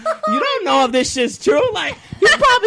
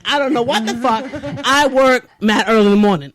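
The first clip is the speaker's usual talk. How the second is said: usual speaking voice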